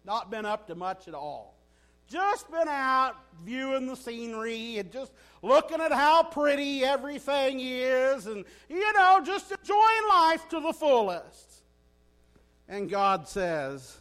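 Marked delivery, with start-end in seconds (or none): 0.0-11.6 mock innocent, breezy and louder at the end; innocent Satan. Satan answering God with feigned innocence, breezy. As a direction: play Satan shrugging it off, all sweetness. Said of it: "Satan says".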